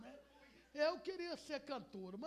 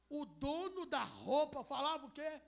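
ordinary talk, nothing marked

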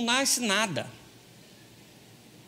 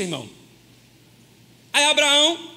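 ordinary delivery

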